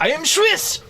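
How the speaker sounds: mock Swiss outburst. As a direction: playfully declare it in a hammed-up Swiss accent. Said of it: "You've heard my accent".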